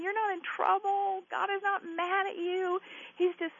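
high, soothing voice; high-pitched comforting tone; tender reassurance. Real, not acted. Reassures caller she's not in trouble.

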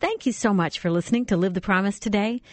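bright upbeat radio promo; radio promo read. Acted. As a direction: sell the website like an ad. Recorded promo read over music.

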